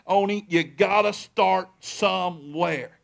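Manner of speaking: slow, every word laid down; slow emphatic point. Acted. Pastor speaks straight to Oni, slow and emphatic. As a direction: land the line slowly and firmly.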